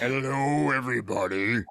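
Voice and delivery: mock deep tough guy; deep puffed-up greeting; extremely deep bass voice. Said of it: "In the deepest voice you could find".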